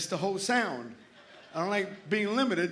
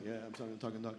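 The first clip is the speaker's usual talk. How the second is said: low, much softer reply; offhand seller; 9 semitones lower, 16 dB quieter, much faster than usual. Acted. Cigarette-smoking seller answers curious guy, offhand and quiet. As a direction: toss it off quietly.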